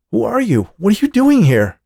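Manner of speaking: high, loud alarmed questions; a man shocked to find a stranger; startled. Acted. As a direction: demand it in a fright.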